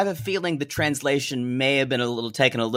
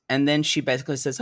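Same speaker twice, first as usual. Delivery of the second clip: ordinary talk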